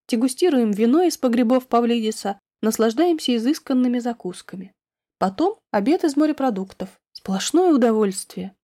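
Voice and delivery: usual speaking voice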